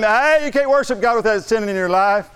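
high scolding devil voice, half-hammed; mock accusing devil. Preacher acting the devil scolding the one praying, high mock accusation. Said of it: "the devil talks like that".

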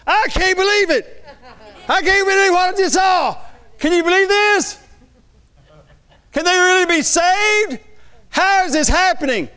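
high, loud cry of disbelief; astonished. Acted. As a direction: shout it out, utterly astonished and high. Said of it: "They were going".

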